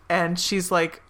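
usual speaking voice

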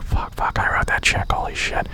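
whispered curses under his breath; barely voiced, horrified whisper; panicked whisper. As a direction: hiss it in a private panic. Panicking to himself over declined card.

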